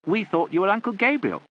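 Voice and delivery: loud and high; shouted. A narrator's reading. Mike shouting to the deaf aunt, loud. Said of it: "shouted Mike".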